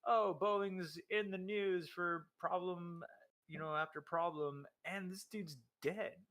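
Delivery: slow, high, overdone newsreader voice; mock news anchor. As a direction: imitate a TV news report, hammed up. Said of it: "where it was just like".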